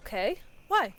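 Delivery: ordinary talk, nothing marked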